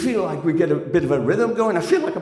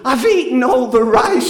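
loud, higher scream; 5 semitones higher, 7 dB louder than usual. Retold or imitated. Soaking partner screaming at man, loud. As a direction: shriek it at him. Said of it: "she's screaming".

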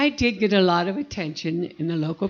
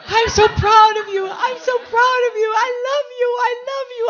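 high, tearful mother, much higher and louder; mother choked up, much higher pitch; mother crying with pride; 6 dB louder than usual. Retold or imitated. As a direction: voice the proud mother through tears. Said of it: "my mother's going".

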